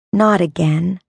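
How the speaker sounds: ordinary delivery, nothing marked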